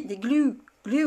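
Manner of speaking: "glue" said carefully as an English word; corrected English word. Shown as a demonstration. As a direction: practise "glue" with a drawn English "oo". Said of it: "que tu me donnes des cours d'anglais".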